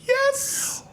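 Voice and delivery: very high, bad cartoon duck voice; a hammed-up cartoon duck squawk, way up high; Donald Duck voice. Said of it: "if I could actually do a Donald Duck voice instead of this horrible con-, uh, monstrosity".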